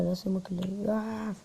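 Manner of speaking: normal talking voice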